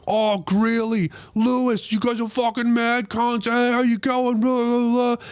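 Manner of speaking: hammed-up rowdy fans calling out; drunk lads greeting. Drunk fans hail the comedian in the street, rowdy high shout. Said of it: "All of them start going".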